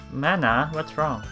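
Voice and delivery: ordinary talk, nothing marked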